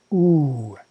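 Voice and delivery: a long, held rounded "oo." as in roof; prolonged oo. Shown as a demonstration. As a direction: stretch "oo." out as the second syllable of Om. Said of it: "but prolonged".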